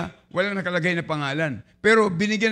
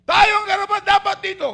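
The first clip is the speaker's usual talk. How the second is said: much higher, emphatic declaration; the thief admitting guilt, raised and high; loud rebuke; 13 semitones higher, 5 dB louder than usual. Acted. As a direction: insist we deserve this. Repeating the thief's line.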